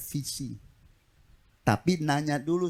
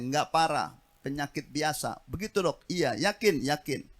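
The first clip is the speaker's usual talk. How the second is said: ordinary delivery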